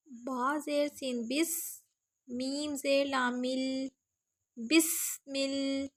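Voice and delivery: slow, soft spelling of بسمل syllable by syllable; teacher sounding out بس and مل slowly. Shown as a demonstration. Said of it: "سین کی آواز کو ہم نے". (بسمل کو ہجے کر کے آہستہ پڑھنا)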